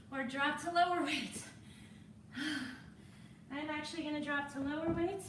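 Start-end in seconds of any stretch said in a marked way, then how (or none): 1.5-3.5 long breathless sigh; weary sigh. Real, not acted. Trainer tiring mid-set, heavy sigh before lighter weights.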